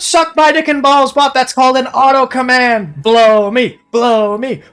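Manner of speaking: screaming in triumph, pitch way up; victory yelling. Real, not acted. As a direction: roar it out like you just won.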